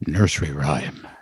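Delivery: very low, slow suspense sting; mock-ominous. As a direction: intone it low and drawn out, like a mock horror reveal. Mock-ominous reveal of story.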